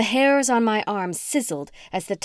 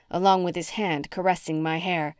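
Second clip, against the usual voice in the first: plain reading voice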